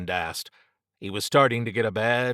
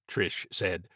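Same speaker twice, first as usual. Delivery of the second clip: ordinary delivery, nothing marked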